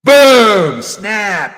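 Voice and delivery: loud sudden boom and snap; loud acted boom; loud mock boom. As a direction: shout the sound effect. Sound effect shout.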